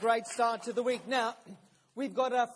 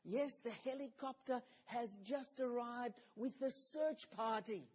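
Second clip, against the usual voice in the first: put-on softer, hushed child voice; whispered; 9 dB quieter than usual. Child whispering news of the helicopter to the caller. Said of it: "Back came the whispered response".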